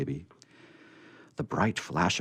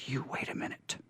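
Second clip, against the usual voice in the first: hushed aside; 6 semitones higher, 10 dB quieter than usual. Acted. Girl hurries off.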